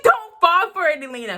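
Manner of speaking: high, loud warning shout; yelling at the character. Retold or imitated. Fan yelling at Alina in the book, loud high warning. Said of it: "And I'm like".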